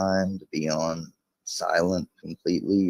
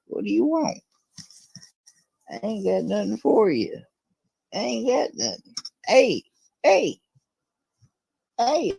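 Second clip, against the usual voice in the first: much higher, squeaky playful whine; 12 semitones higher than usual. Pretend. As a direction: whine like a pinned little creature. Voicing under the pet dog.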